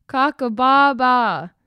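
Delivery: loud, high, slow crow ending in a bleat; a rooster call gone sheepish; silly crow. Acted. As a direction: bellow the crow out proudly, all wrong. Cockerel tries crowing again.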